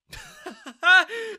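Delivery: high, loud scornful laughter; mocking laugh. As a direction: laugh in his face. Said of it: "[name] started laughing".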